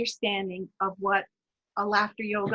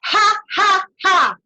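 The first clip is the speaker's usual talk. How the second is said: loud, higher Ha, ha from the low lungs; loud ha ha ha laugh; 8 semitones higher, 10 dB louder, slower than usual. Shown as a demonstration. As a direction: bark out three open laughs. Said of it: "take a deep breath in".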